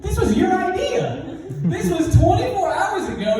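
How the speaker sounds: high-pitched mock indignation; indignant protest. Boy arguing back at his stepdad, indignantly.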